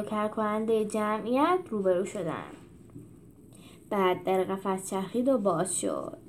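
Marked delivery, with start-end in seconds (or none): none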